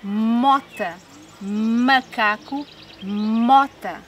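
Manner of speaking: "mota" and "Macaco" each opening on a hummed m; stretched initial sound. Shown as a demonstration. Teacher pairing words by first sound, "mota", "Macaco" with long m. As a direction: hold the m starting "mota" and "Macaco". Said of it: "começa pelo mesmo som".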